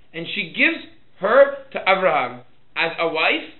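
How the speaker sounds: loud, high, deliberate verse reading; slow reading. Shown as a demonstration. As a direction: read it slowly, phrase by phrase. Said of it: "let's read Pasuk Gimel slowly".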